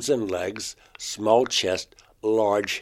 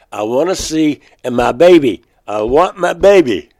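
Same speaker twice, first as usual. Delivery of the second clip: retold pleading, much higher and much louder; pleading whine; 5 semitones higher, 12 dB louder than usual. David begging the insect being to see his baby, pleading. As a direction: beg as if close to tears. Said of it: "letting out a whine".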